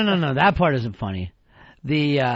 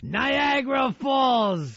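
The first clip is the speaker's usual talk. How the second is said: higher, slow, drawn-out mock exclamation; mock dramatic call; 6 semitones higher, much slower than usual. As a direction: stretch it out like a teasing punchline, pitched up. Teasing crybaby co-host.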